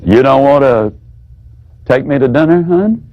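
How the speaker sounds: "You don't want to take me to dinner, hon?" a touch low, lifted into a question on "hon"; low echo, rising end. Shown as a demonstration. Wife repeating her husband's refusal, low and questioning. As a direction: echo it back gently as a question. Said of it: "simply repeat what he said".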